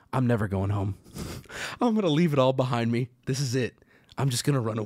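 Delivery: inner voice, low. Retold or imitated. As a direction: drop way low like a defeated inner voice. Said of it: "I start thinking".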